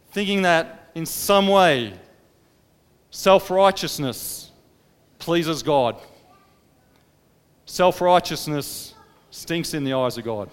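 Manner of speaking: loud, driving sermon delivery; forceful preaching. Acted. Preacher condemning self-righteousness to the church, fervent and loud. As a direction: hammer the point home to the congregation.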